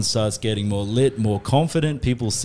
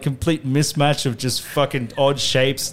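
ordinary talk, nothing marked

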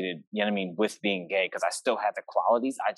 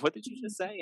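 high, softer incredulous question; offended disbelief; 13 semitones higher, 5 dB quieter than usual. Retold or imitated. Teen challenging mom's comment on clothes, offended disbelief. Retelling their offended reply to mom.

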